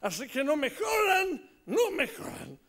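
hammed-up hero with big swooping pitch; superhero. New hero warning off his enemies, swaggering hero voice. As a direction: proclaim it in a put-on voice, stretching the warning. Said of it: "me pidieron que cree el nuevo héroe".

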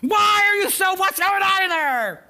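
high, loud, slow cry at the soul; shouted plea. Acted. Pastor voicing the psalmist, high shouted plea. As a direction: shout the question up at him.

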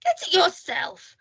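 high, drawn-out cheeky comeback; defiant retort. A narrator's reading. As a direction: answer back with cheek. Mister Cheeky talks back.

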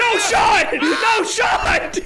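high, loud disbelieving yell; excited shouting. Real, not acted. Player to teammates mid-game, excited shout.

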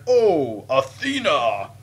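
hammed-up, very drawn-out cry; mock Greek. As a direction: lightly play a Greek, stretching each word. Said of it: "a Greek and it was like".

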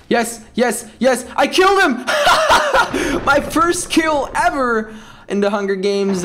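high, loud whoops of victory mixed with laughter; triumphant laughing shout. Real, not acted. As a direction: cheer the first kill, laughing. First kill in the game.

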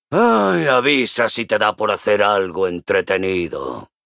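low, listless grumble; bored. Acted. Idle dwarf waiting for some action, bored low grumble.

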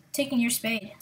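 ordinary talk, nothing marked

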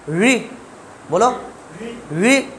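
री with tongue curled up into the hollow; heavy curled-back री; retroflex ri. Shown as a demonstration. Teacher prompting a student to repeat, retroflex री. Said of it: "जीभ को गड्ढे में ले जाओ".

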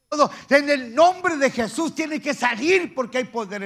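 loud, high, forceful rebuke; fiery pulpit command; full-force preaching. Acted. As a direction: cast out evil at the top of your preaching voice.

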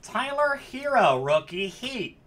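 high, loud hype shout; thrilled, ringing announcement; excited card call. Real, not acted. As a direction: holler the player's name with real thrill. Calling out a big card pull.

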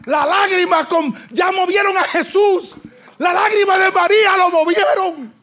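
loud, high preaching, close to shouting; preaching at full force. Acted. (predicando a todo pulmón, casi gritando)

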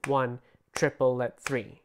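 spoken rhythm count, slow and even; slow rhythmic counting. Shown as a demonstration. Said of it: "So they're gonna go one".

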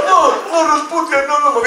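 timid Norbit. Retold or imitated. Norbit denying to Rasputia, a touch of meek pleading. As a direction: plead meekly. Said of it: "grabar todo Norbit".

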